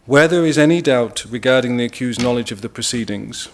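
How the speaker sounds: ordinary talk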